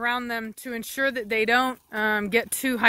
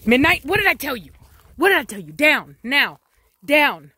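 stern scold; 4 semitones higher, 7 dB louder than usual. Real, not acted. Scolding dog loudly.

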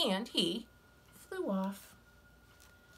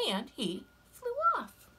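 plain reading voice

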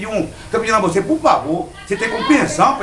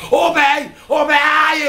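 mock pained yell; 8 semitones higher, slower than usual. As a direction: imitate him crying out as his arm twists. Thug yelling in pain, retold. (grito de dor imitado)

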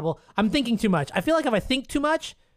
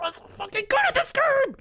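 much higher triumphant cry; emotional boast. Real, not acted. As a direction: crow over the win. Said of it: "I get a little bit emotional".

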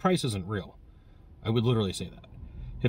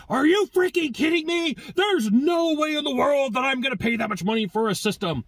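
much higher, louder, ranting like a furious customer; mock temper tantrum; 13 semitones higher, 3 dB louder than usual. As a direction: throw a full-blown adult tantrum, hammed up. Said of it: "throw a full-on adult temper tantrum".

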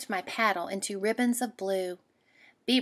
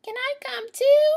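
very high, louder tiny frog; 7 dB louder than usual. Acted. Frog begging the girl, very high squeak.

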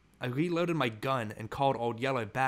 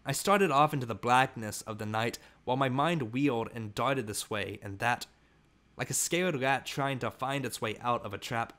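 ordinary delivery, nothing marked

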